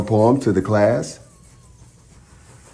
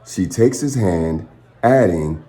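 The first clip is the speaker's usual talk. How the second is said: ordinary delivery, nothing marked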